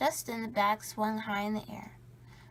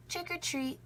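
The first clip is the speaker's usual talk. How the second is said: high, hollow trick-or-treat call; skeleton voice; 8 semitones higher than usual. A narrator's reading. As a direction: sing it out like a little skeleton. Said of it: "said Skeleton".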